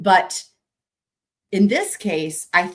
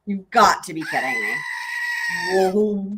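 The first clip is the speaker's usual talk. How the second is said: shouted goat voice. Pretend. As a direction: yell it like an outraged goat. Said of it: "utilize the goat voice here".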